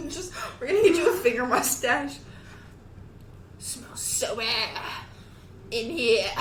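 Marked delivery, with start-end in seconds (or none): 3.6-6.3 put-on slow old lady voice. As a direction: play a creaky old lady. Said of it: "That sounds like an old lady".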